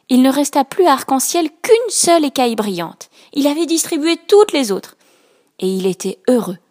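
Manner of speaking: ordinary delivery, nothing marked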